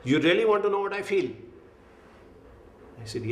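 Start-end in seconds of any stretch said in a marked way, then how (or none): 0.0-1.3 stern challenge. Retold or imitated. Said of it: "gave me a very stern look and said".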